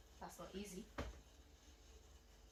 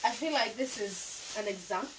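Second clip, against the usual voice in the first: laughing; 8 dB louder than usual. Real, not acted.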